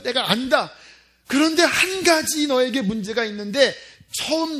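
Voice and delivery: lightly voiced divine reproach, rising in force. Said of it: "주님께서는 슬퍼하신다는". (안타깝게 책망하는 목소리)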